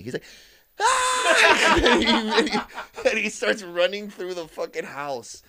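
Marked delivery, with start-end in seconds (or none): 0.8-1.7 high, loud mock scream; imitated scream. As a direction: imitate a friend's shrieking, very high. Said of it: "he starts screaming".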